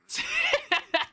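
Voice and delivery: a short high burst of laughter; delighted laugh. Real, not acted. Laughs at a cool weapon.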